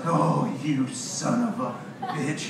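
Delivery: slow, drawn-out mock indignation; imitated exasperated curse. Mom outsmarted by her son over the mail, slow exasperated curse. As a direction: stretch the curse out like an outwitted mother.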